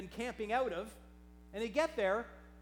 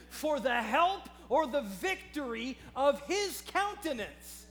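loud, high preacher's command to his own soul; forceful self-exhortation; 5 semitones higher, 8 dB louder than usual. Acted. David turns his voice towards his cast-down soul, forceful self-exhortation. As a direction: preach it at yourself, commanding and insistent. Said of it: "David turns his voice towards himself".

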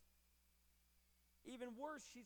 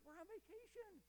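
higher, faster, a mock put-upon disciple; 7 semitones higher than usual. Preacher voicing the disciples wanting rid of the woman, mock dismissive. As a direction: brush her off in a hammed-up high voice. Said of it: "In other words".

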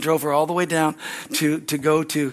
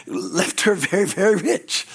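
ordinary talk

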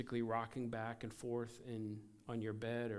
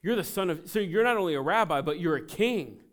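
raised, higher voice, much louder; loud proclamation; 6 semitones higher, 11 dB louder than usual. Acted. Blind man naming Jesus a king, proclaiming loudly. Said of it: "then the blind man says".

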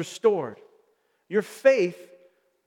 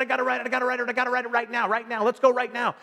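put-on eager child begging to ride, higher and quicker; impatient little boy pleading to go now; excited kid; 5 semitones higher, much faster than usual. Father imitating his son begging to ride, excited.

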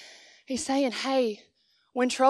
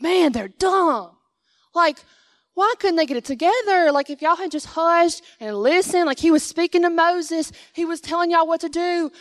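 higher, louder, fed-up disbelief; exasperated rant; 6 semitones higher, 4 dB louder than usual. Retold or imitated. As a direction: vent at them like they should know better. Preacher ranting at the Israelites.

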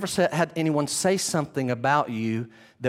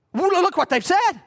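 high, drawn-out accusing cry; Caiaphas confronting; 14 semitones higher, 4 dB louder, slower than usual. Acted. High priest confronting Jesus, high accusing cry. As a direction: thrust the words at him like an outraged high priest.

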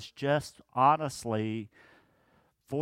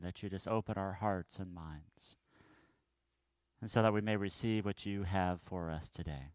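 soft, low prayer voice; 4 semitones lower, 4 dB quieter than usual. Acted. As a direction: pray it quietly and reverently. Closing prayer before sermon.

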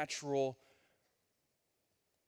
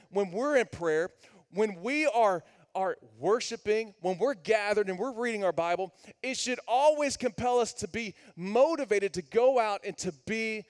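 louder and higher, driving sermon voice; full-force preaching; 6 semitones higher, 7 dB louder than usual. Acted. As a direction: preach it hard to the room.

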